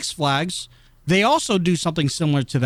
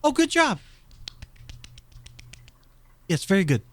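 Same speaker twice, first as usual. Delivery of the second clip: mock applause; 3 semitones higher, faster than usual. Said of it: "The way Quentin will applaud you is like this".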